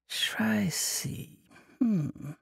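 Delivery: Shrizi whispered, falling; whispered musing. Acted. Monk recalling the name Shrizi, whispered. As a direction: breathe the name to himself.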